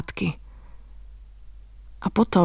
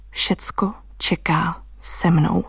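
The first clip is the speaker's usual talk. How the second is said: whisper; 3 semitones lower, much slower than usual. Acted. Intimate narration slows down.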